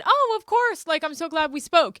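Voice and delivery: high, loud fake enthusiasm; mock gushing. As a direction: gush politely against your instincts. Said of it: "But my mouth said".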